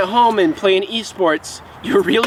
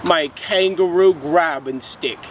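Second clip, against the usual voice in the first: a light put-on Australian accent, hammed-up; goofy mild Australian accent for a drink review; mock Australian accent. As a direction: play a jokey Aussie reviewer with a half-hearted Australian accent. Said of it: "my Mad Lib Australian accent".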